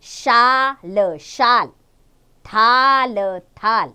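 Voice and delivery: शाल and थाल with a long stretched आ; drawn-out demo words. Shown as a demonstration. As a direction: model the vowel slowly. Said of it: "आ का sound आ रहा है".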